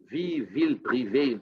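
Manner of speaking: put-on slow, hammy German villain accent; ominous fake German accent; mock German accent. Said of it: "He talks like a Nazi".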